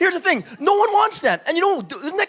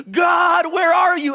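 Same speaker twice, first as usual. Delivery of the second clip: calling out to God, raised and high; desperate outcry; 6 semitones higher, 4 dB louder, slower than usual. Retold or imitated. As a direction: cry out where are you in despair. Prisoner crying out to God.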